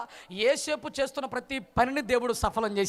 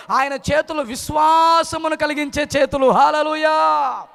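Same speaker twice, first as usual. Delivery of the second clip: full-force preaching; 4 semitones higher, 8 dB louder than usual. Acted. Preacher proclaiming God's hands verse by verse, full-force preaching. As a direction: proclaim each verse, swelling into a shout.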